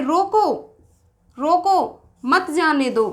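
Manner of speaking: "रोको" alone, pause, then "मत जाने दो" as one phrase; pause after रोको. Shown as a demonstration. Teacher showing what the listener heard, pause after रोको. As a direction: break after each रोको, join मत to जाने. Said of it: "इसने क्या सुना है?".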